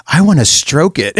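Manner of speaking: put-on slow, innocent little-boy voice, lightly done; a hint of a sweet child asking earnestly; childlike Tiny Tim. Imitating Tiny Tim to friends, slow innocent child voice. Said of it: "And he goes".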